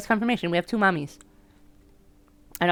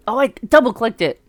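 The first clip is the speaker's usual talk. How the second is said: usual speaking voice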